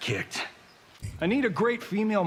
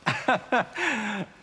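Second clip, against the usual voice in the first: a short amused chuckle; amused laugh; 5 semitones higher than usual. Acted. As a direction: laugh off his boast. Mocking a boastful rival.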